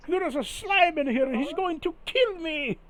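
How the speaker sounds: very high hammed-up wail; panicked plea. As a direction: cry for rescue in mock terror. Victim begs pirate for help.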